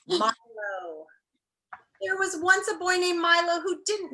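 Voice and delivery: expressive chapter-book reading; reading aloud to children. Acted. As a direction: read the opening to the class like a story. Teacher begins reading chapter aloud.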